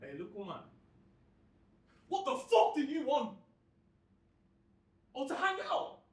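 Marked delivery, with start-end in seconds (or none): 2.1-6.0 high, loud, contemptuous shouting; bully tearing into a smaller boy; angry tirade. Acted. Lad turns on narrator, acted abuse.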